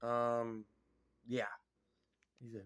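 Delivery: normal talking voice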